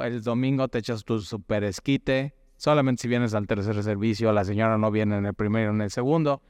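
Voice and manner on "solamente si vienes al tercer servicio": ordinary talk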